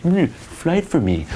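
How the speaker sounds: slow, high mock-sulky whine; butthurt. Shown as a demonstration. Coach showing guys what not to do, butthurt. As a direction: whine it like a wounded sulker. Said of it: "Don't be all butthurt".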